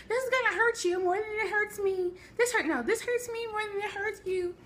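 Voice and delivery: high, strained mock parent voice with a cry in it; parent's whooping line. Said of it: "her parents were".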